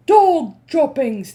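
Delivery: slow, loud, high chant; shouting. Acted. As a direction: shout it in disgust. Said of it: "they shouted".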